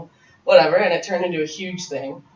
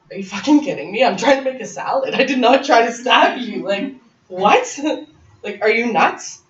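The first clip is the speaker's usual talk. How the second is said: outraged disbelief; 6 semitones higher, 5 dB louder than usual. Retold or imitated. Accused of stabbing, outraged.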